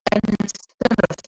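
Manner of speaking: ordinary talk, nothing marked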